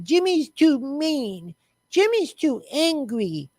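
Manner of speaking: put-on high, slow, drawn-out childish whine; stretched-out, sing-song mocking whimper; mock whining. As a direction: imitate a feeble tattletale complaint. Mimicking complainers about Jimmy.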